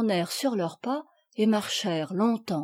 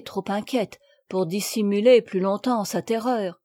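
usual speaking voice

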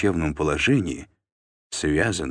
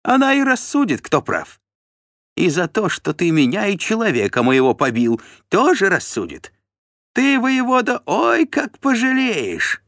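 high, loud, shrill ranting; brazen threat; 6 dB louder than usual. A narrator's reading. Steward threatening the voivode with regret, brazen and shrill. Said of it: "нагло ответил Тиун".

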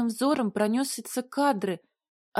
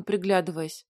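ordinary delivery, nothing marked

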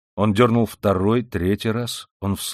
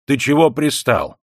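higher, louder annoyed challenge; getting angry; 5 semitones higher, 5 dB louder than usual. A narrator's reading. Yardkeeper telling Raskolnikov off for pestering, angry. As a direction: push the pest away with irritation. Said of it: "начинавший серьёзно сердиться".

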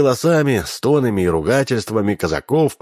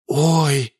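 usual speaking voice

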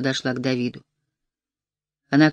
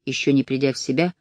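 plain reading voice

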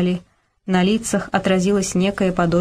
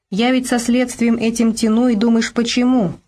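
higher-pitched, wounded reproach; hurt; 5 semitones higher than usual. A narrator's reading. Policeman explaining his stalling to Borka, hurt. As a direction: sound wronged and unappreciated. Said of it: "В голосе Гераскина прозвучала обида".

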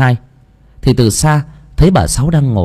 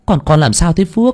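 high, put-on female voice; anxious motherly question, pitched high; worried mother; 8 semitones higher than usual. A narrator's reading. Mother worries over her son. (giọng má cao, lo lắng hỏi con)

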